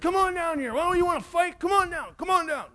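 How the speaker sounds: high-pitched retold bully dare; mock angry challenge. Bully below dares the boys to come down, mock angry. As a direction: play a kid mad and wanting a fight. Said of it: "He got really mad".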